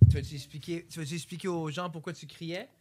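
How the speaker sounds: voix douce et basse; doux. Real, not acted. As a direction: parler tout doucement à l'enfant. Parle doucement à l'enfant. (voix douce de maman)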